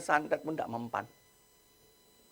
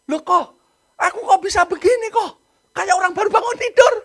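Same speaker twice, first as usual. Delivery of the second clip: much higher, much louder bewildered cry; shocked waking; 11 dB louder than usual. Retold or imitated. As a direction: shout like someone snapping out of a trance. Sister waking from the spell.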